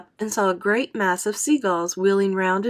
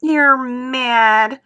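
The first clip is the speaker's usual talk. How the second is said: loud, high outburst of disbelief; a worm crying out that the boy is mad; sharp raised cry; cried out; 7 semitones higher, 9 dB louder than usual. A narrator's reading. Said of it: "cried the earthworm".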